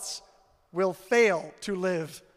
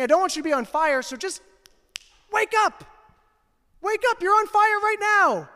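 rising to a full-force cry of wake up, much higher and louder; urgent preaching shout; 7 semitones higher, 4 dB louder than usual. Retold or imitated. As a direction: preach it like an alarm to a burning room. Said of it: "He's saying".

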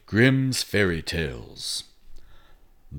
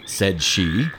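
ordinary delivery

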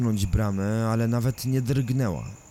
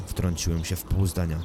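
plain reading voice

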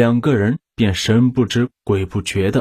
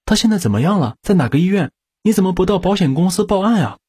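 higher, fast string of worried questions; hurried questions; 8 semitones higher, much faster than usual. A narrator's reading. Agent worried about the insurance claim, rushed anxious. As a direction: fire off questions in a rush. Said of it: "金小姐忙问道".

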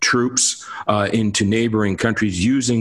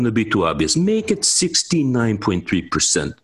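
bad Russian accent; 3 semitones higher than usual. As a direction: put on a clumsy Russian accent, like an official fixing figures. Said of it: "that's my bad Russian accent".

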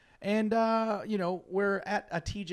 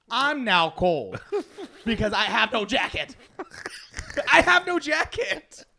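louder, higher, slow and laughing; laughing at lending away his jacket; laughing lament; 8 semitones higher, 9 dB louder than usual. Real, not acted. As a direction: laugh through the complaint. Laughing at own misfortune.

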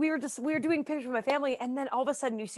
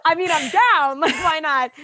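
laughing; 5 semitones higher, 9 dB louder than usual. Retold or imitated. Retold playful agreement.

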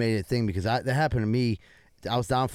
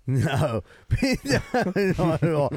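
laughing no, much higher; real laughter, higher and louder; 7 semitones higher, 4 dB louder than usual. Real, not acted. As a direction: laugh through the answer. Laughs at the question.

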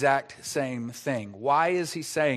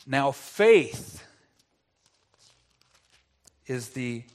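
scripture reading; 3 semitones lower than usual. Acted. Reads scripture slowly, solemnly.

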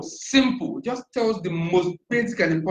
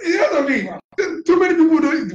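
laughing excitedly; 11 semitones higher than usual. Real, not acted. Excited laugh at surprising fact.

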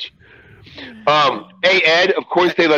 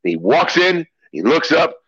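normal talking voice

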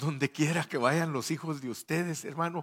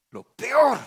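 lo peor shouted high and loud; sudden shout; 12 semitones higher, 12 dB louder than usual. Acted. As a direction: burst out on peor, much higher. Repeats phrase then bursts out.

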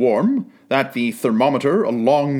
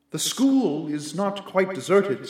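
softer, higher, musing spirit; quiet ghostly spirit; 5 semitones higher, 5 dB quieter than usual. A narrator's reading. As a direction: observe it gently like a ghost. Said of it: "said the ghost".

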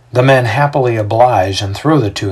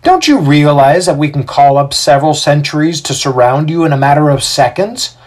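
raised, louder indignant threat; 5 semitones higher, 4 dB louder than usual. A narrator's reading. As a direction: warn the mob with affront. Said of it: "Titus says indignantly".

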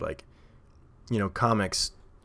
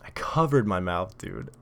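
usual speaking voice

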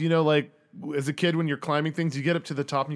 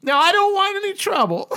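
put-on very high, loud old-lady voice; high-pitched granny protest; elderly woman; 7 dB louder than usual. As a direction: warn him off as a nervous old neighbour. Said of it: "there's a elderly woman".

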